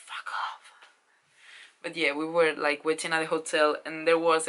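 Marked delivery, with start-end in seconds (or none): 0.0-0.7 hushed whispered swear; whispered curse. Retold or imitated. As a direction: mutter it under your breath. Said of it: "I was like".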